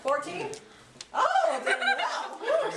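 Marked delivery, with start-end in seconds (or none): 1.1-2.7 high delighted gasp at a bid; surprised. Real, not acted. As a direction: squeal it out with a laugh. Auctioneer spots a big bid.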